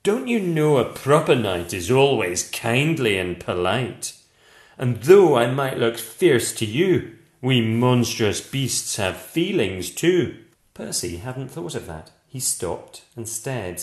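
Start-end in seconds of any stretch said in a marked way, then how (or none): 0.0-10.4 a hurt monster lecturing kindly; gentle monster. A narrator's reading.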